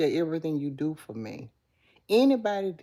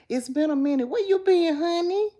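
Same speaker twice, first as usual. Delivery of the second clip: high, loud delighted question; light up at a returning friend; excited welcome back; 13 semitones higher, 5 dB louder than usual. Real, not acted. Welcoming back a viewer, high and delighted.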